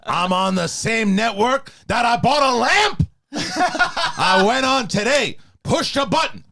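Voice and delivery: yelling Al Pacino. Acted. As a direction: rant like late-career Pacino. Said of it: "The yelling?".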